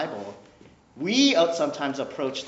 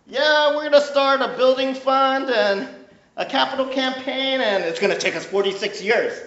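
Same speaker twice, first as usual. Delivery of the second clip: higher, louder hammed-up pastor pitch; mock pastor; 10 semitones higher, 8 dB louder than usual. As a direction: sell the building fund like an overeager pastor. Parodies pastor pitching building fund.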